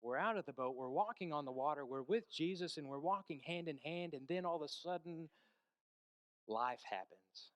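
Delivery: normal talking voice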